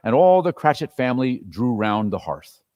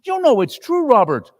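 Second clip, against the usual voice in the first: angry wife; 10 semitones higher than usual. Acted. Missus Cratchit fuming at Scrooge in front of Bob. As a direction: let the anger boil over.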